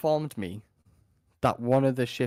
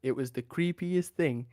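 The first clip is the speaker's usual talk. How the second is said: usual speaking voice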